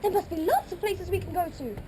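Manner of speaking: high, fast, close to tears; panicked bride. Acted. Bride begging her father before the wedding, tearful. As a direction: cry out for Papa in desperation.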